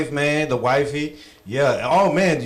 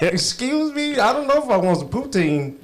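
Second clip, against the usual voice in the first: mock suspicious; 9 semitones higher than usual. Mock offended reaction continued.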